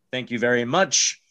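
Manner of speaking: ordinary delivery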